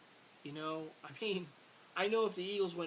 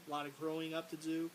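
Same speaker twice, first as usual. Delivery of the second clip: ordinary talk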